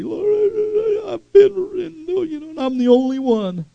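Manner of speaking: high, slow, crying whine; mock sob of self-pity; hammed-up weepy complaint to the Lord; whiny pity party. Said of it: "he's having a pity party".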